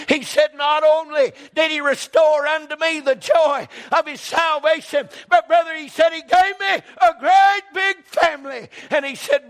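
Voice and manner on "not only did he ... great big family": high, loud shouted testimony; retold shouting. Preacher retelling Rodney's testimony, loud and high shouted. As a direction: shout it like the friend did. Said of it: "He got to shouting".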